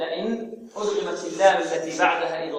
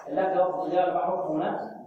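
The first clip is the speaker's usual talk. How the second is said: normal talking voice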